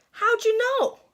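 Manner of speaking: high, startled question; caught-out surprise. Acted. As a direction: gasp it as if caught. Sheriff caught out.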